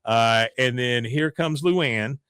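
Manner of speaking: ordinary delivery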